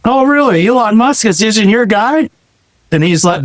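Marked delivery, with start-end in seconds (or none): 0.0-2.3 mock amazement, pitched higher; sarcastic disbelief. Advisor mocking a prospect's CPA with sarcastic surprise. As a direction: play it up like you can't believe it's true. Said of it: "I'm like".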